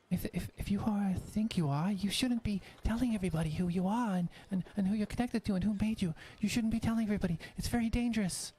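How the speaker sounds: high and hurried, speeding up; high-pitched apprentice, anxious and rushing; Jonas, urgent warning. Acted. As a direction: warn him fast before anyone hears. Jonas urgently warns Atlas.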